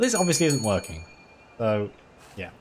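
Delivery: normal talking voice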